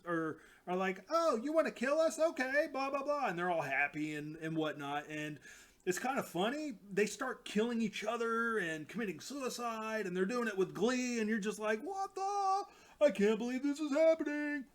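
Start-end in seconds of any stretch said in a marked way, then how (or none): 1.1-3.3 much higher, louder giddy creature voice; a happy little critter thrilled by the threat; gleeful creature voice. Retold or imitated. Bunny creatures answering Thanos's threat, high and gleeful. 11.8-14.7 much higher, louder stunned outburst; shocked disbelief. Retold or imitated. Reader reacting to the bunnies' killing, high and shocked.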